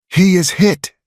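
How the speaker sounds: high, loud wild triumph; triumphant yell. Acted. Martha yelling in triumph after the hit. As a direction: yell it in savage victory. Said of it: "she yelled, a wild, savage triumph".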